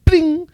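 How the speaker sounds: high, ringing "bling"; bright little cartoon ping; imitated popping plug. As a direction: mimic a plug pinging loose.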